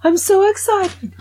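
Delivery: mock teen squealing with excitement; gushing girl. Girl in shop video.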